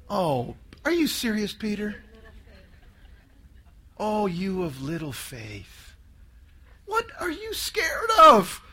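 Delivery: a quiet, unbothered chiding; Jesus, calm. Acted. Jesus gently chiding scared Peter, soft and calm. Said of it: "what did he say".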